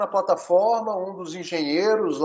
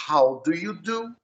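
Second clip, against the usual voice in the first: "How do you do?" spoken slowly and clearly; slow model phrase; slower than usual. Shown as a demonstration. Teacher models a greeting for the student to repeat. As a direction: lay out each word slowly. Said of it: "Aí tem outro aqui".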